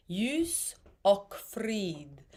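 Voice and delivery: Ljus och frid, och sung with a hard K. Shown as a demonstration. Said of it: "that is a hard K".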